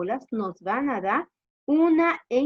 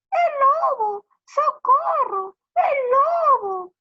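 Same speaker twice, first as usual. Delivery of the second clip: high, loud, long-held wail; shouted alarm; 8 dB louder, slower than usual. Acted. Shepherd pranks the villagers once more, shouting. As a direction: cry for help with stretched vowels. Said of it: "volvió a gritar".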